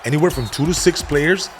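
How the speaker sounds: punchy, hyped-up podcast sponsor pitch; casual ad read. Acted. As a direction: streetwise promo read over music. Reads sponsor ad for Prize Picks.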